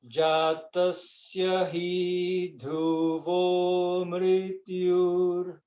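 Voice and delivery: Jatasya hi dhruvo mrityur, each syllable drawn out slowly; slow, careful mantra recitation. Shown as a demonstration. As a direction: recite the Sanskrit line slowly for students to copy. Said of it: "Ouçam com atenção".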